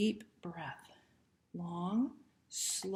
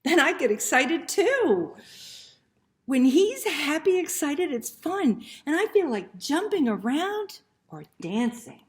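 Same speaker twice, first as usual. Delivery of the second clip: much higher and louder, bubbling over with excitement; excited; 6 semitones higher, 10 dB louder than usual. Acted. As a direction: let the joy burst out like you want to jump. Narrator gets excited with Ollie.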